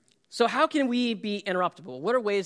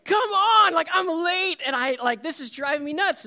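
exasperated outburst; 12 semitones higher, 6 dB louder than usual. Retold or imitated. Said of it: "I'm just like".

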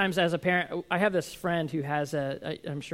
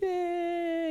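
mock whimper; 13 semitones higher than usual. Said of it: "he's like".

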